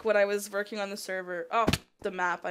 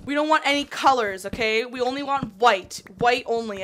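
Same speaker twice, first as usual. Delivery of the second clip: loud, higher-pitched emphatic declaration; loud self-quote; 3 semitones higher, 10 dB louder than usual. Retold or imitated. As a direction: call it out loudly, insisting. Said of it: "I was like".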